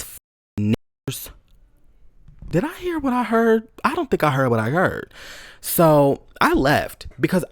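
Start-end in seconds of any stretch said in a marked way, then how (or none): 2.5-5.1 high, incredulous; can't believe your ears; shocked inner question; stunned disbelief. Retold or imitated. Disbelief at overheard slur.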